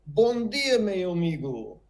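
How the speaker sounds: retold learner's "Bom dia, meu amigo", lifted in pitch; attempted Portuguese greeting. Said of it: "I can say that in Portuguese".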